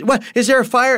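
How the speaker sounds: high, alarmed half-asleep question; jolted from sleep, fearing a fire; startled wake-up. Retold or imitated. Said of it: "She goes".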